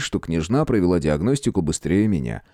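plain reading voice

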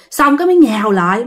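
usual speaking voice

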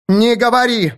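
high, sharp shout; alarmed cry. A narrator's reading. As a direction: cry out to stop him. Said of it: "вскричала Аланис".

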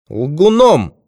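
high, loud, cutting retort; woman's high, biting accusation; sharp retort. A narrator's reading. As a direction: throw it back at him sharply. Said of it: "резко проговорила она".

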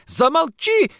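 very high, loud hysterical cry; anguished shriek; screamed. Acted. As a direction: scream to shut him up. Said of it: "закричала она".

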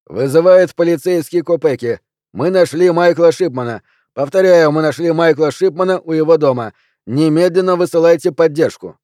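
loud, high, urgent report; police radio call. A narrator's reading. Policeman radioing for backup, loud and high. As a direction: call it in loudly to dispatch.

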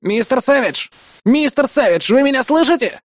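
high-pitched anxious voice over a speaker; Isamu, agitated. A narrator's reading. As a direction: call anxiously, trying to raise someone. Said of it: "произнёс взволнованный голос Изаму из динамика".

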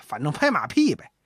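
usual speaking voice

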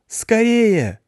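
high-pitched slow yell of a little creature; shrill-voiced cartoon mop calling out. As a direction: squeal for the boss to come. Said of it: "завопила из зала швабра".

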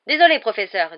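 loud apology. Acted. Commentator apologizing to the professor, loud and hurried.

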